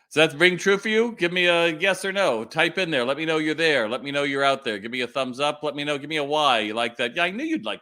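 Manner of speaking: calling out to viewers. Real, not acted. Calling out to live chat.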